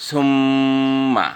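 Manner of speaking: সুম্মা with the doubled ম stretched and humming; slow teaching recital of সুম্মা, nasal ম held long; nasal hold. Shown as a demonstration. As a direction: draw out the ম in সুম্মা very slowly. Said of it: "আপনার উচ্চারণ হতে হবে সুম্মা".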